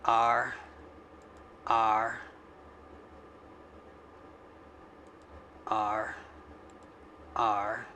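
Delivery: low pirate "Arr" sounds. Shown as a demonstration. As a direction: growl each R like a pirate. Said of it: "Yeah, I sound like a pirate".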